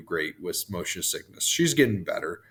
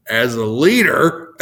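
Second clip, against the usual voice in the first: suppressed laugh; 5 semitones higher, 10 dB louder, slower than usual. Real, not acted. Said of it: "That's funny".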